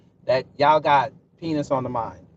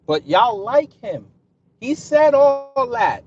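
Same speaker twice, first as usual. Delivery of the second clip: high, slow, loud disbelief; mocking the supporters' choice; incredulous; 8 semitones higher, 4 dB louder, slower than usual. Real, not acted. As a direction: stretch it out in disbelief.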